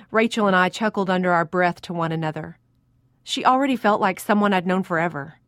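usual speaking voice

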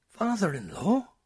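put-on high, rising girl's question; astonished girl. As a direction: gasp it out in disbelief. Girl startled by revelation.

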